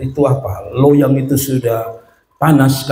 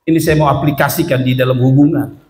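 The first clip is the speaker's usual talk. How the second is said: ordinary delivery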